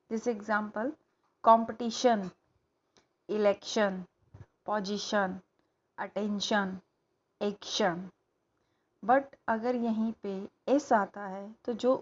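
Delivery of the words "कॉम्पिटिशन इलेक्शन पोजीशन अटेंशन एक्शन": कॉम्पिटिशन, इलेक्शन, पोजीशन, अटेंशन, एक्शन said with a clear "shan" ending; shun ending. Shown as a demonstration. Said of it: "वो last में शन का आता है". ("शन" की ध्वनि के साथ साफ़ उच्चारण)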